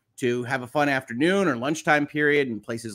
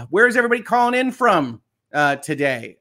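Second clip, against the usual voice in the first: excited call-out; 6 semitones higher, 5 dB louder than usual. Real, not acted. Host greets live chat viewers.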